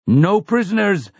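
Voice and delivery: high screamed battle cry; screaming. Acted. Roland screams the order in the charge, high. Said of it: "Roland screams".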